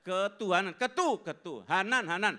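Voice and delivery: Ketuhanan split slowly, syllable by syllable; syllable drill. Shown as a demonstration. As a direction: say ketu then hanan apart, slowly. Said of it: "dibilangin ketuhanan".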